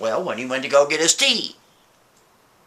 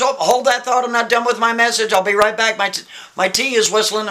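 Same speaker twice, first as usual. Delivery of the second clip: higher, urgent call to hold on; interrupting himself; 6 semitones higher than usual. Acted. Man calls to Rachel that his kettle is whistling, urgent. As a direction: break off the message in a higher voice.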